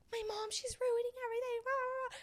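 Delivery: high, soft, fast complaining; mock whiny bride. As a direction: rattle off the complaint like a petulant bride. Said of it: "she's like".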